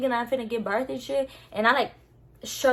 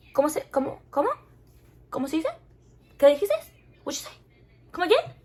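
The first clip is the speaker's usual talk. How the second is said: baffled disbelief; 6 semitones higher, much faster than usual. Retold or imitated. Acting out her baffled disbelief.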